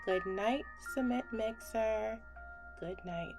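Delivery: plain reading voice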